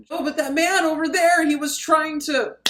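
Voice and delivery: high-pitched, hammed-up frightened protest; mock panic. Woman explaining her scare to the doctor, high mock panic.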